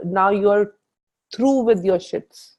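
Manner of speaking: usual speaking voice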